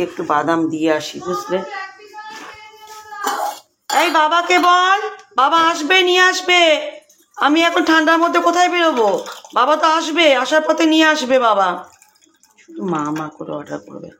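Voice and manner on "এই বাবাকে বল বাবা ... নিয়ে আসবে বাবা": real raised-voice reply to someone further off; calling out. Real, not acted. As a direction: raise the pitch and call out insistently. Calling out to child about errand.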